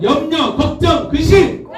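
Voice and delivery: high-pitched call and response lead; climbing call, word by word; rallying call. Real, not acted. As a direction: rouse the crowd to shout back. Repeated cue for shouted response.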